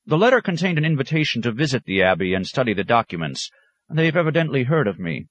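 a proud scholar pressing his point; keen academic pressing the monsignor; scholar's voice. Acted. Scholar Thon Taddeo speaking.

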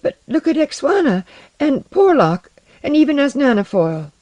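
put-on high, frightened child-like protest; scared children. A narrator's reading. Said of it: "chattered like scared children".

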